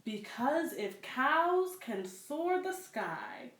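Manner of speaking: put-on reading aloud to children, unhurried; story narration. A narrator's reading. Librarian reads a picture book to children, storytelling voice. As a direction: tell the tale gently to little listeners.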